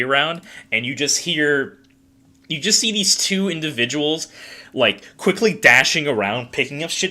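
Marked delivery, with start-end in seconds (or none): none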